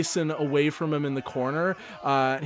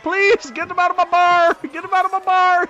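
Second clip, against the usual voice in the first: very high, loud panicked yell; panicked bartender; 9 dB louder than usual. Acted. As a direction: beg in a panic. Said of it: "He's like".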